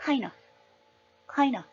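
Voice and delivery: "kind of" heard as kain-uh; "kind of" with the final f dropped; reduced kind of. Shown as a demonstration. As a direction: shrink "kind of" to a quick kain-uh. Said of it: "이렇게 들리는 거거든요".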